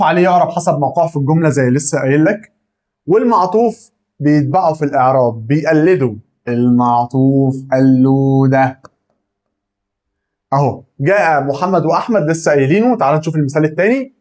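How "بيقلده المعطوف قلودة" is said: قلودة stretched out slowly; a slow, teasing stress on قلودة; drawn-out copycat. Shown as a demonstration. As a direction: say قلودة long and teasing, like a nickname.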